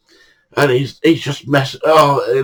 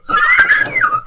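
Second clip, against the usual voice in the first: a hearty laugh at the memory; big laugh. Real, not acted. Laughs at his own story.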